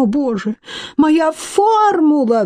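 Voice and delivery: high, drawn-out squeal of anguish; shrieked squeal. A narrator's reading. Scientist mourns his lost formula, squealing. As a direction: squeal it high, stretching the last word. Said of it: "взвизгнул он".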